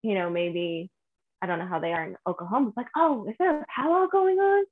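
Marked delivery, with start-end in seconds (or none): 3.0-4.7 high-pitched mock question; curious stranger. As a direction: imitate an onlooker asking eagerly. Imitates curious strangers asking.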